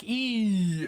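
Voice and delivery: sudden high, loud "Ee"; mock Russian squeal. Mocking the Balmer accent with a high, loud "Ee". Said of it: "Nobody can tell you're Russian when you're just like".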